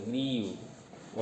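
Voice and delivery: Grieve said slowly and softly with a long "ee"; stress on vowel. Shown as a demonstration. Teacher showing the vowel sound in grieve, vowel drawn out. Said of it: "इसमें ई vowel sound है".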